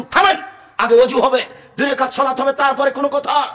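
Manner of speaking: forceful voiced command from Sara; commanding halt. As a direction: rear up and stop him cold. Sara halts the tyrant.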